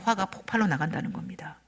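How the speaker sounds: unvoiced dramatic whisper. Real, not acted. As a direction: drop to a breathy whisper for weight. 설교 중 강조 속삭임. (속삭이듯 강조하며)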